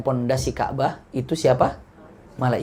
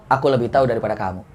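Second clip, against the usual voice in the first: lower, quick and firm, no room for argument; short and sharp; 6 semitones lower, 4 dB quieter, faster than usual. Acted. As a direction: close the question with a curt answer. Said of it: "Pendek, mantap, tajam". (jawaban pendek, mantap, tajam)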